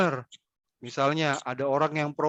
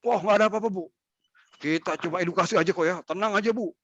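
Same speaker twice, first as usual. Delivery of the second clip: authoritative officer; 4 semitones higher, faster than usual. Shown as a demonstration. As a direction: talk down to her with authority. (nada berwibawa seperti petugas)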